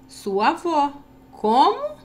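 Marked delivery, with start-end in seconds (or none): 1.3-2.0 "Como?" much higher and louder, rising sharply; a startled "Como?" shooting up; surprised question. Shown as a demonstration. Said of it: "entonando corretamente as pontuações".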